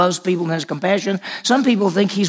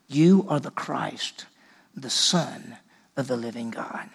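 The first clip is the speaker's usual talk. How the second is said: very slow and softer, fading into a whisper; hushed confession; 5 semitones lower, 7 dB quieter, slower than usual. Acted. Peter answering Jesus, a slow, fading reverent hush. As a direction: let it sink to a reverent whisper.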